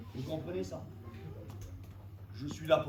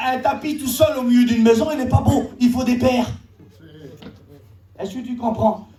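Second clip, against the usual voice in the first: raised, insistent sermon voice; fervent preaching; 7 semitones higher, 9 dB louder than usual. Real, not acted. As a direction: press the congregation hard, phrase after phrase. Preaches unity to the church. (prédication fervente et insistante)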